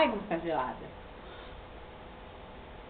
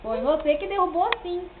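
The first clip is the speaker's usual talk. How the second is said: 1 playful accusing; 10 semitones higher, 9 dB louder than usual. Real, not acted. Provocando a criança.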